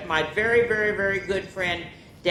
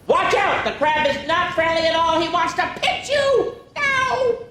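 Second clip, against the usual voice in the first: high loud laughing alarm; mock panic; alarmed warning; 12 semitones higher, 6 dB louder than usual. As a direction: shout a playful warning. Crab not friendly, alarm.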